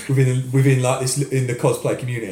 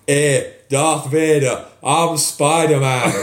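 slow, loud, drawn-out mock Spider-Man; mocking impression; 5 dB louder, much slower than usual. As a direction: ham up a clumsy fan's Spider-Man greeting. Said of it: "do my best impression of him".

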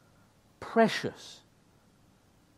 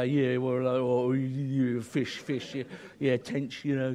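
lightly voiced mumbling old fisherman, lower; old bloke way; 4 semitones lower than usual. Preacher imitating how to ask a wily fisherman, a light old bloke voice. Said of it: "you've sort of got to talk like this".

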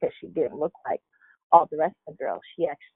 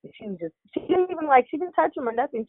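ordinary talk, nothing marked

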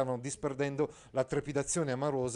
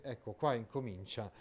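ordinary delivery

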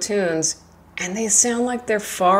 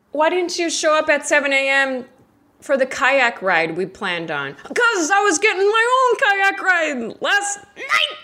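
mock exchange; 7 semitones higher than usual. Friend's question then retort.

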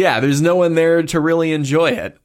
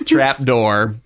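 normal talking voice

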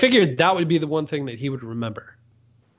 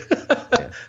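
shy high laugh; 11 semitones higher than usual. Real, not acted. Flattered laugh.

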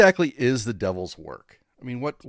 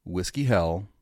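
announcer intro. Acted. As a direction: announce the show opening.

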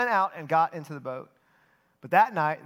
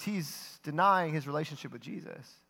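usual speaking voice

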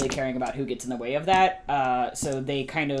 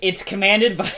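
normal talking voice

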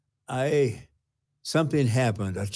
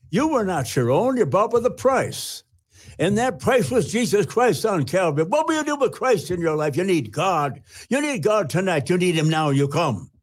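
raised, fervent evangelist preaching; preacher voice; 8 semitones higher than usual. Retold or imitated. Old man imitating an evangelist preaching at a crowd. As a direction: preach it out like Billy Graham from the screen. Retold Billy Graham preaching.

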